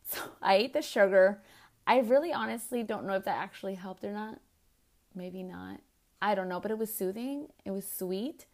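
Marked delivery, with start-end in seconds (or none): none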